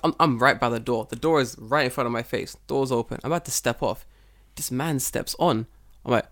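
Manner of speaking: ordinary talk, nothing marked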